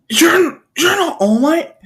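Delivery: very high, stammered question; stunned disbelief. Acted. Boy seeing the hero transform, stunned. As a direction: squeak it out in shock.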